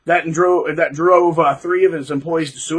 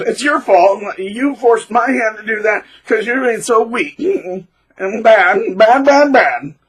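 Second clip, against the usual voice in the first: a hint of a higher, louder blaming abuser; mock blaming abuser; 5 semitones higher, 4 dB louder, slower than usual. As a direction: scold the victim as if it were their fault. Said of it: "And then".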